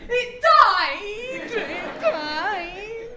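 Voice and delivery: loud, high wail that it died; mock panic over a dead toy; panicked cry. Comic wailing over a dead Tamagotchi, loud.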